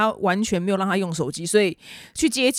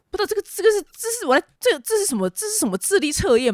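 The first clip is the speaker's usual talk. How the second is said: baffled; 11 semitones higher than usual. Real, not acted. Baffled by the form.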